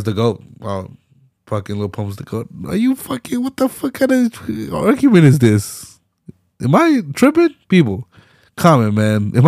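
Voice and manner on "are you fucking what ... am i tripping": much higher pitched, exasperated disbelief; incredulous outburst. Real, not acted. Streamer asking chat if he's tripping, high incredulous outburst. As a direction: cry out to the viewers, baffled. Outraged at the argument.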